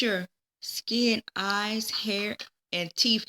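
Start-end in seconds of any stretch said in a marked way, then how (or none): none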